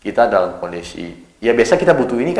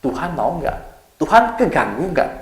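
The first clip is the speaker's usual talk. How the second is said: anxious doubt; 5 semitones higher than usual. Retold or imitated. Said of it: "yang kedua adalah".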